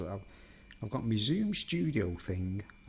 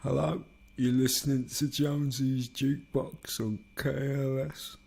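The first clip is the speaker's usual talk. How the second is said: higher-pitched put-on DJ voice; radio DJ; 4 semitones higher than usual. DJ introducing Jonesy's Jukebox to listeners, mock radio voice. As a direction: play a jukebox show host, drawing out the station name. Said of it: "radio D J sound".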